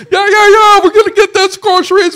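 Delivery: very loud and high, yelling into a hands-free phone; mock loud Bluetooth caller; loud phone talker. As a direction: bellow it like someone on speakerphone in the car. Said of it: "the people on their Bluetooth".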